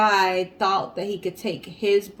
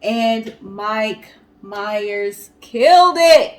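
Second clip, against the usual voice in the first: slow and louder, leaning on killed; emphatic praise; 8 dB louder, much slower than usual. Real, not acted. Host praising Mike Myers to the co-host, slow and emphatic. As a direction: stretch out "killed" for big praise.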